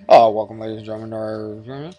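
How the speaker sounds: low, trailing-off cabin announcement; pilot voice. Pretend. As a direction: mumble a mock airline welcome like a captain. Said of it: "he does his pilot voice".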